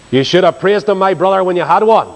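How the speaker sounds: ordinary talk, nothing marked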